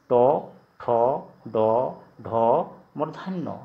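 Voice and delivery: "टो, ठो, डो, ढो" each separate and drawn out; Assamese letter recitation. Shown as a demonstration. Teacher showing how Assamese is read, measured letter recitation. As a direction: recite "टो, ठो, डो, ढो" slowly with round o. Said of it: "असमिया में ऐसे पढ़ेंगे".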